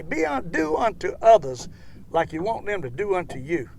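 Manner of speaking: ordinary delivery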